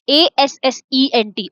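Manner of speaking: एएसएसईएनटी spelled letter by letter, slowly; spelling out. Shown as a demonstration. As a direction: spell each letter out for the class. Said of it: "teacher spells the word". (अक्षर-अक्षर धीरे बोलना)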